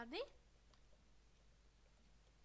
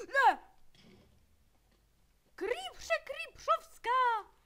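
loud horrified drawn-out shout; gasping shout; 8 semitones higher, 15 dB louder, much slower than usual. Real, not acted. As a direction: gasp then high, loud slow drawn-out cry. Creeper explodes.

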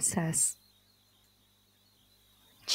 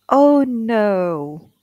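groan; 7 dB louder than usual. A narrator's reading. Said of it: "groaned the king".

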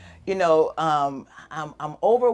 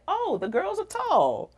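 high, drawn-out wonder; delighted realization; 13 semitones higher, slower than usual. Retold or imitated. Misfit finally seeing relatives like herself, amazed delight. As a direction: marvel slowly in a high voice.

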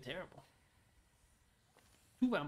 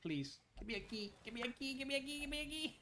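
high-pitched pleading, climbing higher; frantic begging; 9 semitones higher, 3 dB quieter than usual. Real, not acted. Gamer begging the game for a key, frantic high pleading. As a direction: beg over and over, rising to a squeal.

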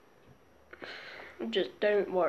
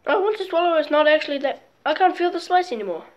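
after eating wasabi; 7 semitones higher, 6 dB louder than usual. Real, not acted.

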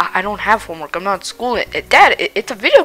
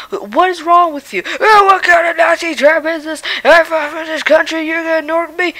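cranky, hard-of-hearing grandpa voice; a deaf old dad barking back; grumpy old man; 9 semitones higher than usual. Acted.

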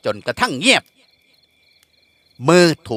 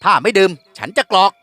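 gruff demand; 4 semitones higher, much slower than usual. A narrator's reading. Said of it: "ถามเสียงดุๆ".

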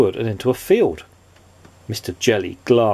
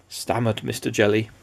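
usual speaking voice

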